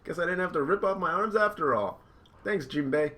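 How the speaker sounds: high, loud anime hero; Luffy voice. Acted. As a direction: blurt it out like a cartoon boy. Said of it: "Luffy's like".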